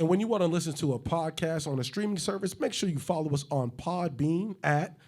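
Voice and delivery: hyped-up ad read, a bit high and loud; promo read. Acted. Host plugging the podcast, upbeat promo voice. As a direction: sell the podcast like a radio spot. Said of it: "hype promo".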